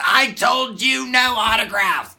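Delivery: furious shout imitating the bodyguard; loud, slow, high-pitched yell; screaming. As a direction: scream it in someone's face, high and drawn out. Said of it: "he screamed right in my face".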